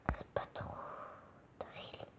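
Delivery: whispered aside. Real, not acted. Gamer whispering to himself mid-match. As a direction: breathe it under your breath. Quiet aside while playing.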